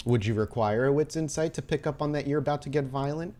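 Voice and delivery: normal talking voice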